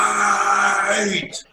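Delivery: long, drawn-out high shout; imitated battle cry of a coach; coach yelling out. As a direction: yell it from the bench, stretching the word. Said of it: "my head coach from the bench just yell out".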